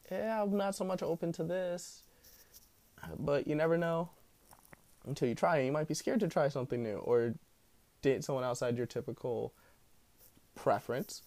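ordinary talk, nothing marked

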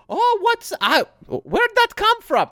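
mock-innocent, very high-pitched grandma; feigned innocence. Grandson retelling how grandma played dumb about the hidden steak, feigned innocence. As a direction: imitate her playing clueless in a squeaky high voice. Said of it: "she pretended like she".

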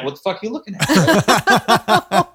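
high, slow confrontational mock voice; imitating annoyed stranger. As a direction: snap back as the offended stranger. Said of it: "are they gonna be like".

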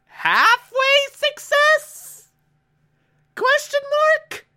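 cartoonish confused query; mock puzzled squeak. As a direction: squeak it out as if baffled. Mock puzzled about the plot.

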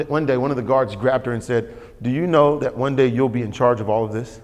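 ordinary delivery

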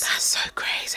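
soft unvoiced whisper. Real, not acted. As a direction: whisper back in shock. Whispered reaction.

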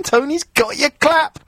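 gloating sing-song, drawn out and high; sing-song taunt. Acted. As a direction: taunt him like a playground singsong, climbing in pitch. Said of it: "teasing Wayne about losing his".

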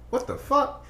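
high incredulous what the fuck; stunned disbelief. Retold or imitated. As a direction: squeak it out in shock. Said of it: "I'm like".

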